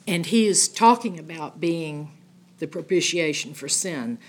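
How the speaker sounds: usual speaking voice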